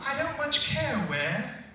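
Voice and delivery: a hint of a polite British girl asking the way; British Alice. Dad reading bedtime story to his kids, light British accent for Alice. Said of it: "they wanted Alice to be British".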